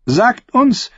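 high, emphatic royal demand; commanding. A narrator's reading. Queen demands the singer's confession, commanding. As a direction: order him to confess. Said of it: "befahl sie".

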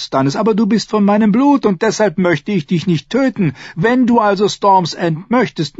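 high-voiced lord, condescending to his brother; Renly, proud younger brother. A narrator's reading. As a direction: grant it loftily in a high voice. (hohe, herablassende Stimme)

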